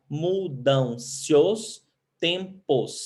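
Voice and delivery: very slow, each syllable apart; counting syllables. Shown as a demonstration. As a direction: stretch it out so the class can count. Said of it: "cinco sílabas". (bem devagar, separando as sílabas)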